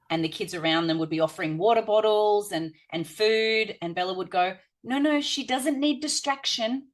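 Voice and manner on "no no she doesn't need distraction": put-on higher childlike voice, firm and earnest; earnest child correcting classmates; little girl insisting. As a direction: play a small girl telling the others to stop. Bella voiced stopping other kids.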